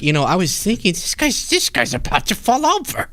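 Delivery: playful high imitation of a wife on TV; mock wife voice. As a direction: lampoon the wife's TV confession in a high voice. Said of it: "you just hear her being like".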